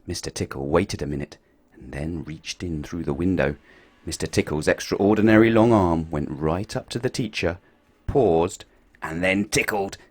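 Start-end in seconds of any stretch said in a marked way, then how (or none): none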